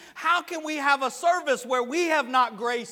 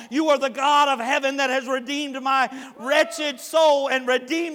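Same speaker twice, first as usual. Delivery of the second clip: loud declaration building higher to a climax; full-force preaching; 4 semitones higher, 7 dB louder than usual. Acted. Preacher declaring his devotion to God, full-force preaching. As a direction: cry it out to God, building to a big finish.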